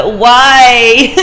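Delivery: hammed-up whining why; dramatic why. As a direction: play up the despair, then laugh. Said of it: "becomes dramatic".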